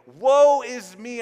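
loud slow cry of Isaiah's woe; mock lament. Preacher voicing Isaiah's wail to the congregation, loud and slow. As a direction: wail it out like a doomed prophet.

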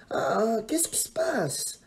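whispered, just woken; groggy groan. A narrator's reading. Léa just woken by the scream, groggy and whispered. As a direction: mumble half asleep. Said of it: "Elle grogne".

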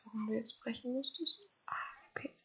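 tonlos geflüstert; flüster es beiseite; Flüstern. Real, not acted. Flüstert plötzlich. (leise geflüstert, fast tonlos)

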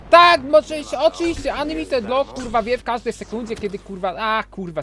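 high, loud furious yelling at the game; furious gamer rant. Real, not acted. Gamer raging at the boss blocking every hit, furious high yelling. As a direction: scream your rage at the cheating boss.

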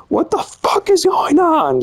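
very high-pitched imitation of a screaming parent; mock screaming. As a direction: mimic an outraged shriek without full volume. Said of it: "Just screaming and shit like that".

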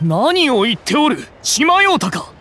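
sharp, high indignant retort; indignant outrage. Acted. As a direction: rise up in offended disbelief. Adept indignant at being accused.

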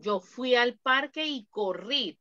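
normal talking voice

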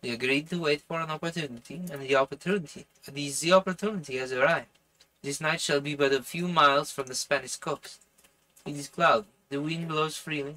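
put-on French-accented character voice; earnest plotter with a French accent. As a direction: read the dialogue in a French accent. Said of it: "I'm doing this French accent".

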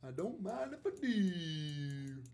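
stretched out slow and savoring; slow drawn-out. Shown as a demonstration. Streamer savors the win for chat, slow and drawn out. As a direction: draw out every word, gloating. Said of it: "repeats it drawn out".

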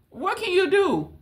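high, helpless mock yell of a tiny person; tiny squeaky voice. Shown as a demonstration. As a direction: squeak it like someone tiny shouting at the universe. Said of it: "All you can do is yell".

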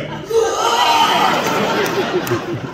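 loud, very high laugh; big laugh. Real, not acted. Actor reliving his first Godzilla film, loud laugh. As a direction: let out a big delighted laugh. Childhood joy at Godzilla winning.

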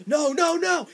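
high, loud cry of no as it goes wrong; loud high panicked no; panicked yell. Retold or imitated. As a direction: shout it like your bike is crashing. Bike crashes, he panics.

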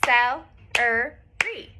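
celery very slow, each syllable apart; cel-e-ry, slowly clapped. Shown as a demonstration. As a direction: stretch celery out, one clap per syllable. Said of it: "now I'm gonna clap it".

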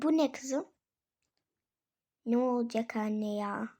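normal talking voice

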